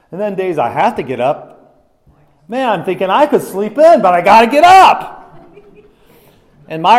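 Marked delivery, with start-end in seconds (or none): 2.5-5.2 loud, high-pitched acted grumble; frustrated complaint. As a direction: build to an exasperated yell. Acts out frustration at early rising.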